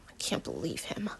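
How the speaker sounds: helpless mutter. Acted. Alex muttering to himself after losing the argument, helpless whisper. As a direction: mutter it under your breath, helpless. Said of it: "I mutter helplessly".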